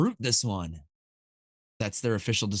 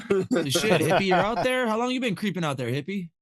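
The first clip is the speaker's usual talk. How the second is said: surprised; 8 semitones higher than usual. Real, not acted. Surprised greeting.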